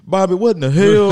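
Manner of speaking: King of the Hill. Retold or imitated. Cartoon dad yelling at Bobby, loud high impression. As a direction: holler it like an exasperated cartoon father. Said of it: "King of the Hill".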